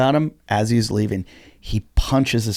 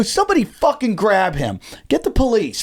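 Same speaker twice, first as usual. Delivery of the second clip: higher urgent command; retold yell; 8 semitones higher than usual. Comic calling the crowd to seize the attacker, retold yell. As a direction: order the crowd to act.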